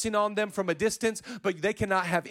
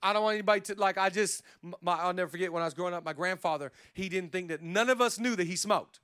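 ordinary talk, nothing marked

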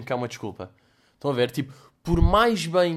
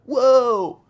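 mock wow; 10 semitones higher, 9 dB louder than usual. Real, not acted. Reacting to embarrassing photo.

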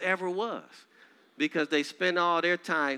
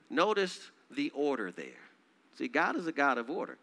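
ordinary talk, nothing marked

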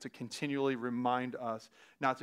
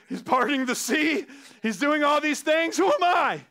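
rising to a loud, high, panicked cry; overwhelmed Joshua; 10 semitones higher, 7 dB louder than usual. Retold or imitated. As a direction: voice Joshua's panic at his own task. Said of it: "he's probably thinking".

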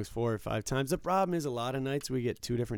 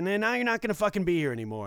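snapping at him; 8 semitones higher, 5 dB louder, faster than usual. Retold or imitated. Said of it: "it made me snap".

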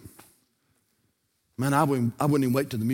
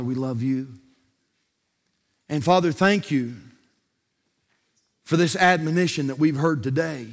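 pastoral prayer. Acted. Closing prayer and altar call.

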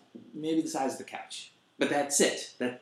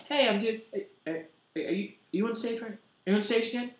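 imitating Stamos; 8 semitones higher, faster than usual. Stamos voiced in story.

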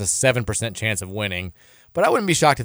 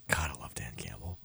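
whispering; 11 semitones lower, 16 dB quieter than usual. Real, not acted. Sudden whisper about Lions.

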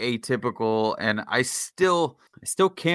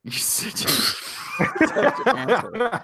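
laughing; 11 semitones higher than usual. Real, not acted. Laughing insult at friend.

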